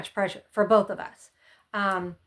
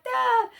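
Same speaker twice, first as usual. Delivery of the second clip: high mock gasp; 3 dB louder than usual. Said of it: "And we're like".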